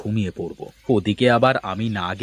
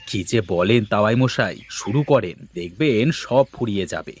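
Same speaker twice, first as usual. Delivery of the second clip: ordinary talk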